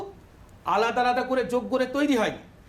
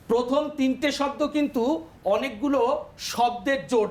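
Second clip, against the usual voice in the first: ordinary talk, nothing marked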